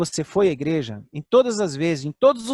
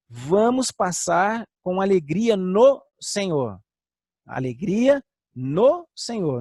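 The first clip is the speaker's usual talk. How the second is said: slow preaching exhortation; 2 semitones higher, much slower than usual. Acted.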